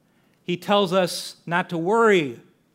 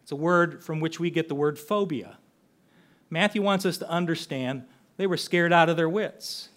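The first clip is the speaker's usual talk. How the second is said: ordinary delivery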